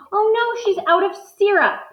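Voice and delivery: put-on gasping, high oh no for the kids; mock dismay. Teacher gasping for the class at a story twist. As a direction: play up the surprise.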